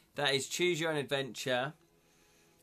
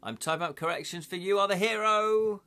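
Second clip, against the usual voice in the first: normal talking voice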